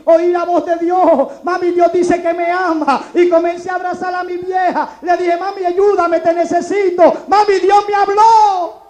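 loud, slow, emotional proclamation; impassioned cry to mother. Acted. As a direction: build to a high shouted declaration. Cries out joyfully to his mother.

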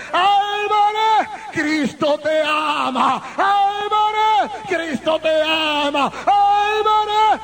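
high, drawn-out repeated cries; calling out. Retold or imitated. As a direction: call the name high and long, urgently. Pastor calls out Christ's love. (clamor agudo y alargado)

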